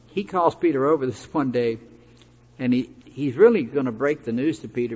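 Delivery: normal talking voice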